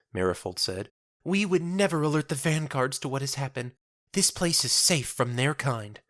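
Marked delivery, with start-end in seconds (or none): 1.2-6.0 put-on a kindly young church sister soothing a stranger; gentle churchwoman. Marifold consoling Jenna in a high gentle voice. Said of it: "whispered in a comforting voice".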